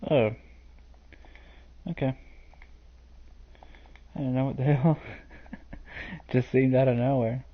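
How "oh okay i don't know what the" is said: ordinary delivery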